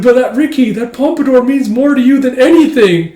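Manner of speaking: hammed-up schoolgirl plea; girlish plea. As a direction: beg him like a melodrama heroine. Girl pleads in recap.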